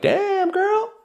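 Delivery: high, put-on "Damn, girl"; stung surprise. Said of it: "I'm like".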